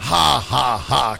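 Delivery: dry, groaned mock laugh; quiet groan. A narrator's reading. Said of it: "kam's leise Stöhnen vom Sofa".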